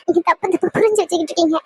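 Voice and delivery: ordinary talk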